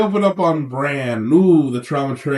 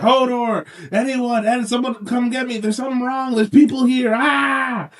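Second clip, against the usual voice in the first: mock screaming; 8 semitones higher than usual. Bran waking up and screaming for people, high mock yell. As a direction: call out high in pretend panic. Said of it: "He starts screaming for people".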